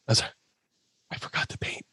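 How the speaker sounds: unvoiced whisper of a sheepish confession. Whispering to Sandman that the paint is forgotten. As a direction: breathe it out so nobody hears.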